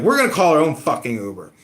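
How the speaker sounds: loud, defiant retort; angry friends. Retold or imitated. Friends telling John they'll book their own ride, loud and defiant. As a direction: snap back, fed up.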